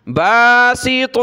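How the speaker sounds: باسط with a heavy, thick ط; full-mouthed ط. Shown as a demonstration. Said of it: "तो को मोटा किया".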